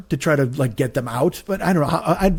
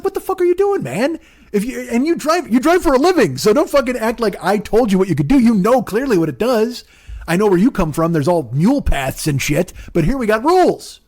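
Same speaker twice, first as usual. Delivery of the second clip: high-pitched indignant rant; acting out an exasperated passenger telling off a driver; mad; 7 semitones higher than usual. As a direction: scold in a raised, incredulous pitch. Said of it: "part of me was mad".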